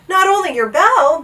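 loud high cry. A narrator's reading. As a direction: cry it out urgently. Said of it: "Bartholomew cried".

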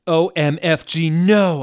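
high, loud falling scream. A narrator's reading. Girl screaming while falling through the floor. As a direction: shriek it as you fall. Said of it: "I screamed as I fell down".

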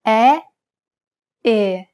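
first E open and wide, second E closed and narrow; open then closed E. Shown as a demonstration. Teacher contrasting the open E with the closed E. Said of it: "Compare the open E followed by a closed E".

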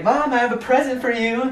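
modelling little sister's line. Pretend. Brother shows sister how to give mom the present, high-pitched. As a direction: lift it high, pretending to be the sister. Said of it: "I need you to be like".

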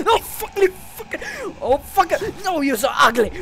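high, loud startled outburst; panicked yelling. Real, not acted. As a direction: cry out in alarm at the monster. Monster appears, player cries out.

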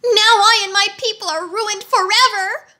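high, mildly tearful wail; a touch of sobbing, voice high; sobbing wheeler. A narrator's reading. Ruined wheeler laments to Tick-Tock, mild high sob. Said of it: "he sobbed".

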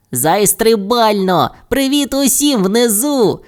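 high, drawn-out happy call; slow, high-pitched hurrah; cheerful shouting. A narrator's reading. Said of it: "кричали Бу, Боб і Бао".

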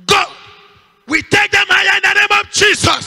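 high, sustained declaration; shouted blessing. Real, not acted. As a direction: proclaim it forcefully over the congregation. Shouted blessing over children.

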